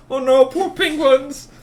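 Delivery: cartoonish mock lament; mock dismay. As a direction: wail over the poor penguins in a put-on voice. Mock pity for imagined penguins.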